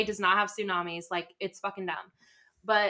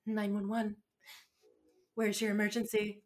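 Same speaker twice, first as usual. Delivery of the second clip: soft, subdued imitation of dispatcher; mildly acted, pulling herself together; tearful composure; 5 dB quieter than usual. Dispatcher answering after a hard call, softly composed. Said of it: "she wipes her tears and she goes".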